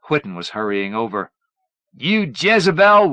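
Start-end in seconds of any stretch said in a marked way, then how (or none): 1.9-3.1 slow, high, very loud shout. A narrator's reading. Son shouting an insult at his mother. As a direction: hurl the insult at full voice. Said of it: "Wayne shouted".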